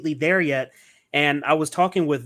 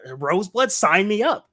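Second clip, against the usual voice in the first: higher-pitched, enthusiastic mock reply; eager sign-up; 7 semitones higher than usual. Fan wishing he had backed the film, eager and rising. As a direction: jump at the offer, pitch climbing.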